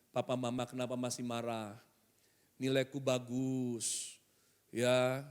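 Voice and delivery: soft, low, subdued pleading; subdued, meek questioning; hurt child. Acted. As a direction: play a well-behaved child quietly asking why. Good child asking parents, third scenario.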